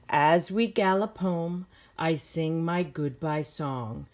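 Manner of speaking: slow, gentle storybook reading; reading to children. A narrator's reading. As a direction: read it like a bedtime story to kids. Teacher reads picture book aloud.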